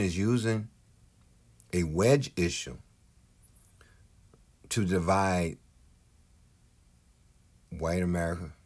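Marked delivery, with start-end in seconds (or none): none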